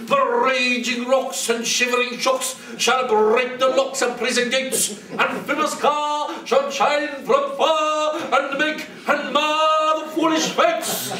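lofty ranting hero. Acted. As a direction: rant it like a mighty tyrant. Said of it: "This was lofty".

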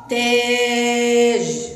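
تاج with the long aa stretched very slowly and held up; long drawn-out vowel. Shown as a demonstration. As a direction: draw out the aa in تاج. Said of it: "تنطق فتحة طويلة لأعلى".

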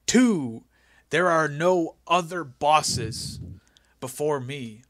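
slow, booming mock-biblical decree; mock commandments. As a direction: hand down the law slowly. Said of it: "he goes".

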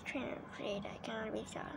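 breathy unvoiced whisper; hushed so the hamster stays calm. Real, not acted. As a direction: barely breathe the words. Whispered aside.

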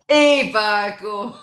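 put-on Yorkshire accent. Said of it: "in my best Yorkshire accent".

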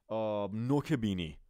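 ordinary talk, nothing marked

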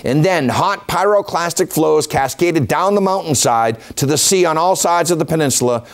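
ordinary talk, nothing marked

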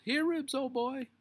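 high, loud yell to a dog; yelled call. A narrator's reading. Henry stuck in thorns calling Ribsy, high yell. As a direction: yell for the dog at full pitch. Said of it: "he yelled".

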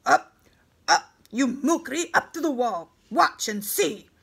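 loud, high-pitched order to captives; barked military command; shouted command. Acted. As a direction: drive the prisoners on with loud, forceful orders. Captor prods prisoners forward with orders.

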